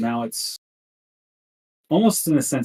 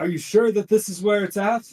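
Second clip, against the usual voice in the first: raised, quick, high-pitched question; captain calling out; 9 semitones higher, faster than usual. Acted. Captain questions Miss Opal in the storm, calling out. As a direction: call out the doubt in a high voice. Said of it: "yells out".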